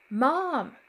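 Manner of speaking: high, loud desperate call; crying out. A narrator's reading. Brother calling mother through the noise, crying out. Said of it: "Ricky cried".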